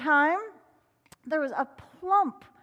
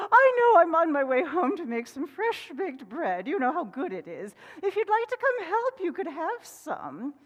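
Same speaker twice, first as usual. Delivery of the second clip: higher, louder hen offer; 5 semitones higher, 5 dB louder than usual. Acted. Hen inviting Fox to help, higher voice. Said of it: "She said".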